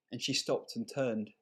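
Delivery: ordinary delivery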